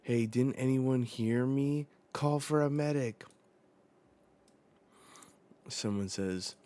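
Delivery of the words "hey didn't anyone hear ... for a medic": raised, louder demand for help; urgent call. Acted. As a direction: call out to anyone who can hear. Acting repeated call for medic.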